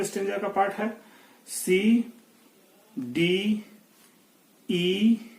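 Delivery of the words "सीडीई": spelled letters. Shown as a demonstration. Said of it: "सी हम चलेंगे".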